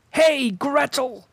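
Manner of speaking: high, slow calling shout. A narrator's reading. Witch summoning Gretel, high shout. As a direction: call her over from across the house. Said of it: "she shouted to the girl".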